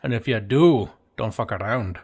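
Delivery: put-on Scottish burr; mock Scottish accent, measured delivery. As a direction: warn them in a comic Scottish accent. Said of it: "sounded better if he's like".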